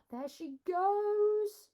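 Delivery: wide-eyed storyteller wonder; excited exclaim. Real, not acted. Reader exclaims at the picture.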